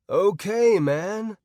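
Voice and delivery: high, laid-back stoner drawl; loose, lazy surfer-dude voice. Acted. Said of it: "I said in my best stoner voice".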